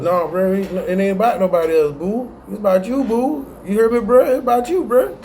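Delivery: high-pitched teasing insistence; playful insistence. Real, not acted. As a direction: tease them that it is all about them. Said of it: "answers the viewers himself".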